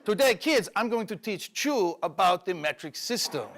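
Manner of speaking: put-on Colombian accent, deliberate delivery; thick mock Colombian accent, punching key words; Carlos, Colombian accent. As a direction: teach kids as a hammed-up Colombian drug dealer. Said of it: "my friend Carlos from Bogota".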